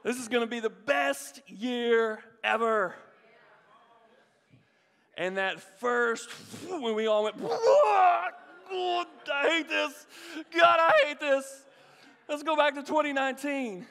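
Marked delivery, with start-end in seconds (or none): 0.0-2.9 loud, slow hyped-up boast; overconfident proclamation. Acted. People walking into twenty twenty, loudly overconfident. Acts confident new-year boast. 7.4-11.6 high, loud hammed-up scream; mock panic. Everyone hit by twenty twenty, screaming in mock panic. Acts panic when year lurched. 12.3-13.9 loud, high mock plea; mock panicked plea. People hit by twenty twenty, begging in mock panic.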